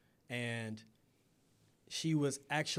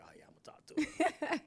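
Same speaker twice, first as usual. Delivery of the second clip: laughing; 13 semitones higher than usual. Retold or imitated. Retold eager thought at club.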